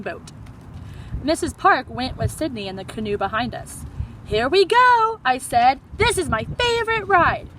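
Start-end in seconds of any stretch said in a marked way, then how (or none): 4.2-7.5 high and loud thrill; can't wait for the ride; excited. Acted. Kid boarding the canoe ride, excited.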